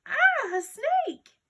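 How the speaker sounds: drawn-out high, loud scream of fright; startled girl shrieks at the snake. A narrator's reading. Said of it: "Alexia shrieked".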